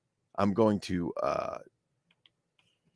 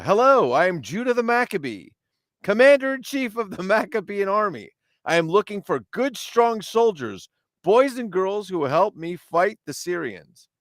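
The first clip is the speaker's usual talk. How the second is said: high-pitched, declamatory army commander; rally young recruits with a grand proclamation; hammed-up heroic storybook leader; Judah the Maccabee; 12 semitones higher, 4 dB louder than usual.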